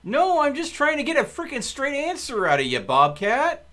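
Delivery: high, loud exasperated outburst; frustrated shout. Real, not acted. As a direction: yell at Bobcat in frustration. Frustrated argument with teammate Bobcat.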